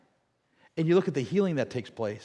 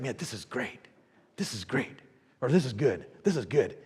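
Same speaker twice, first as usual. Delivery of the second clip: much softer, lower quote; content friend; 6 semitones lower, 7 dB quieter than usual. Retold or imitated. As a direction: murmur the refrain contentedly. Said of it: "The guy's like".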